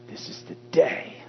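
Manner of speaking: hushed, whispery build to DAY; stress on DAY. Shown as a demonstration. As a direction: whisper the line, land on DAY. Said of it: "When you break it up and say".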